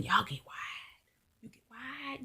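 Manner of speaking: slow teasing whisper; whispered aside. Real, not acted. Vlogger teasing her family about getting wide, in a whisper. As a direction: lean in and whisper the dig, slowly.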